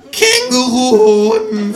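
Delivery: very high, loud, drawn-out shriek of the word; startled outburst. A narrator's reading. Kangaroo jolts awake at the word, shrieking. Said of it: "fährt das Känguru aus seiner Trance empor".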